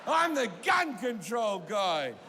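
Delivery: excited shout. Retold or imitated. Comic thrilled that the billionaire knows his bit. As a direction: burst out with eager relief.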